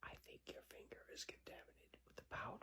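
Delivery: unvoiced whisper. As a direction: breathe it as a whisper. Repeated line, now whispered.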